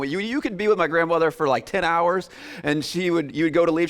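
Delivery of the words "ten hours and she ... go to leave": usual speaking voice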